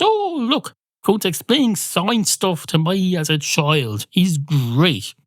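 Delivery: high, gushing mock fan voice; hammed-up excited admirer. Mocking Americans praising science man.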